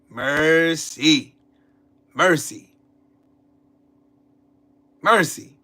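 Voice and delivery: low weary mercy; drawn-out exasperated mutter; exasperated sigh. Real, not acted. Streamer shaking his head at the chat, low and weary.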